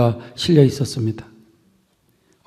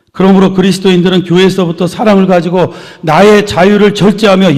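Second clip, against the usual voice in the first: louder, higher exhortation from the pulpit; forceful preaching; 4 semitones higher, 7 dB louder than usual. Real, not acted. Pastor urging believers to restrain their freedom, forceful preaching. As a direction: drive each phrase home to the congregation.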